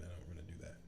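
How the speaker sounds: whispered, under the breath; whispered aside. Real, not acted.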